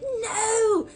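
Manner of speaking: high, drawn-out mock cry of alarm; cartoon character's cry. He sees the boy fall through, high cartoon wail. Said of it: "He has this accent".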